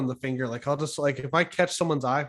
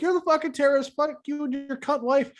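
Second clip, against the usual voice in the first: high yelled insults at the truckers; angry Canadian yelling; balcony yell; 10 semitones higher than usual. Retold or imitated. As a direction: shout down from the balcony. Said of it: "dude's on his balcony yelling".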